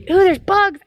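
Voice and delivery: high-pitched bug alarm; real squeamish outburst; startled alarm. Real, not acted. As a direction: cry out, startled by bugs. Startled by bugs near the car.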